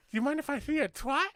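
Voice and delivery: a dreamy little man with a speech impediment. Retold or imitated. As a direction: joke teller voicing the little man admiring the horse. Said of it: "he's got a fucking speech impediment".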